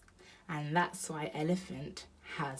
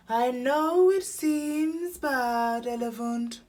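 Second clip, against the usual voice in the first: high, slow, drawn-out owl voice; stretched, hooting consolation; comforting Owl; 7 semitones higher, 8 dB louder, slower than usual. A narrator's reading.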